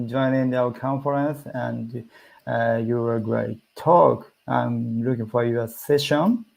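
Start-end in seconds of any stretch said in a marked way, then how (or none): none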